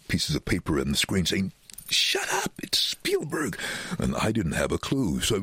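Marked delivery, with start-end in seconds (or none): 1.9-3.6 high-pitched mock-frantic warning; urgent, pitched-up mimicry; panicked warning. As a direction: imitate producers desperately shushing him. Mimics producers' frantic written warning.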